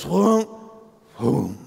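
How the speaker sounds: imitated whoom of a boat dropping; boat settling whoosh. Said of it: "the boat just goes".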